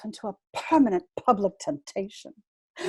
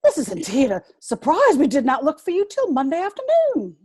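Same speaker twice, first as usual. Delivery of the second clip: flustered surprise; 5 semitones higher, 6 dB louder than usual. Acted. Greeting the unexpected guest.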